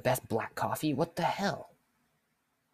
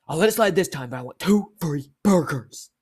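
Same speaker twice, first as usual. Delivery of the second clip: mad customer; 3 semitones higher, 8 dB louder than usual. Retold or imitated. Customer demanding free burgers, acted angry. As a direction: lay down terms like an irate customer. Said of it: "This guy's mad".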